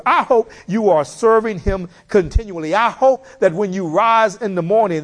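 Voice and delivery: rising, impassioned preacher urging the church; fervent preaching. Acted. Preacher pleading with the congregation to serve God, fervently. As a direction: pour it out from the pulpit. Preaching exhortation, raised pitch.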